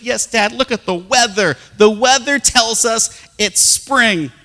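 put-on high, loud, slow gushing child; excited daughter. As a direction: gush like an eager little girl. Daughter insisting.

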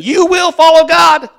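shouted high and loud like a heavenly herald; booming angel command. Acted. Angel commanding the whole world, booming shout. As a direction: thunder it down from heaven.

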